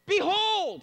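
loud, high proclamation; exclaimed behold. Retold or imitated. John calls the crowd to attention, loud. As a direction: ring it out to the crowd. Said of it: "he just stopped".

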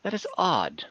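a hint of a puzzled NPC musing aloud; quizzical Kierna. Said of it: "quizzical look on her face and says".

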